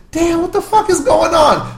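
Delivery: high, slow, astonished; stunned bystanders. Retold or imitated. Onlookers watching him knock a guy out, high and stunned. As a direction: gape in disbelief. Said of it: "they're all just looking like".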